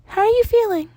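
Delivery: high, bright little principal voice; a cheerful small creature greeting a student; high Nezu voice. Acted. Principal Nezu welcomes Danny into his office, in a high cheerful voice.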